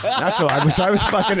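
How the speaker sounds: ordinary talk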